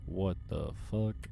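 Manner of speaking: slow, hushed disbelief; stunned. Acted. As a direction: let it out slowly and softly, stunned. Stunned witness to a monster emerging.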